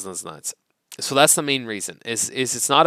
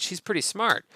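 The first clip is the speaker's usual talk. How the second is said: raised pitch, impressed; 8 semitones higher, 3 dB quieter than usual. Shown as a demonstration. Teacher models an impressed remark, higher. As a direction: sound impressed by her. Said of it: "you're impressed".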